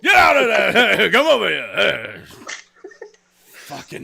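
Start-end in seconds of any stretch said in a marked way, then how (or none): 0.0-2.2 loud wailing seal holler, easing off; blathering mock shout, dragging out the end; [name]. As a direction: bellow it like a maniac seal. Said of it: "he sounds like he might be a boxer".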